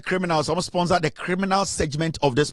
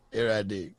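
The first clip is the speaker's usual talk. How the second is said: low laughing aside; 9 semitones lower, 8 dB quieter than usual. Real, not acted. Laughing at own mockery.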